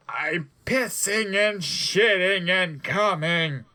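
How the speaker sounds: hammed-up slow screaming villain; panicked scream. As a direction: cry it out in mock panic. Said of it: "Snoop screamed".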